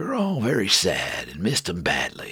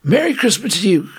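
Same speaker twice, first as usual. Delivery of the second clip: put-on young soldier voice; 9 semitones higher, 4 dB louder than usual. Lefty voiced.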